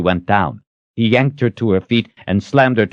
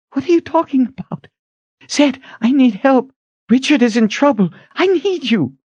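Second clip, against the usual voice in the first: high, urgent appeal for help; pleading heroine; 3 dB louder than usual. A narrator's reading. Kalen begging Zed to help Richard, urgent and high. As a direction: plead in a much higher character voice.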